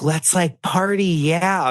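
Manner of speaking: put-on drawn-out frat-bro accent; Patrick Suzuki. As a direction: stretch it out in a parody frat accent. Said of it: "doing everyone being as Patrick Suzuki".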